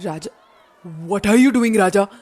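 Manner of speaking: ordinary delivery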